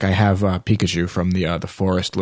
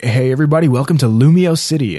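higher-pitched imitation of a video host; raised, announcing video-intro voice; video host's greeting; 6 semitones higher, 4 dB louder than usual. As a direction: imitate an upbeat welcome to viewers. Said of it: "and he's like".

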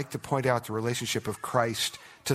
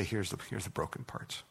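softer, hushed confession; humble offering; 3 semitones lower, 9 dB quieter than usual. Retold or imitated. Believer bringing broken marriage to Jesus, hushed and humble. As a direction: hand it over quietly, humbled. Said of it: "you come to Jesus and you say".

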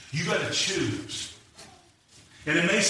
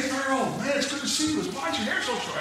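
high-pitched imitated hello from old pals; friendly greeting; 10 semitones higher than usual.

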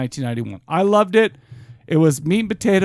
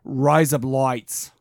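slow, lower Australian "Rise Up Lights"; demonstrated Australian accent; 5 semitones lower, much slower than usual. Shown as a demonstration. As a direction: draw out "Rise Up Lights" as Australian "razor blades". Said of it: "That is Australian for razor blades".

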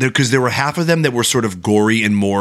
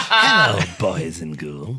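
slow ghoulish horror host greeting; Crypt Keeper voice; 3 semitones lower, slower than usual. Acted. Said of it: "Yeah, that's better".

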